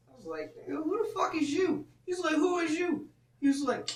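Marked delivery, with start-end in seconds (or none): 0.6-1.9 high-pitched retold challenge; confrontational. Man challenging the other man who walked in, confrontational. 2.4-3.1 high-pitched imitation of a rival's comeback; challenging retort. Other man firing back at him, challenging.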